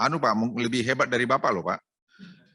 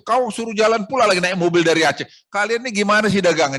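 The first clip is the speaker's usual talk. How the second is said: raised, louder mock scolding; retold angry scolding; 6 semitones higher, 6 dB louder than usual. Minister retelling scolding his Aceh colleagues, louder and higher. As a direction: replay telling off the Aceh friends, higher and louder. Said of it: "Saya marah lagi teman-teman Aceh".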